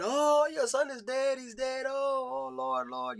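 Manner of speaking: high, wailing mock panic; hammed-up high lament; frantic wailing woman. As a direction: imitate a frantic mother crying out to the Lord. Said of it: "He didn't answer the door with a frantic woman".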